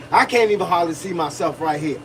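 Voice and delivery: ordinary talk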